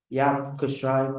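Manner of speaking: ordinary talk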